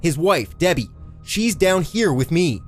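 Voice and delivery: high, urgent hollered plea; hollering. A narrator's reading. Eddie explains himself to the cop from the pit, hollering. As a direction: holler to make him listen. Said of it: "drew a deep breath and hollered".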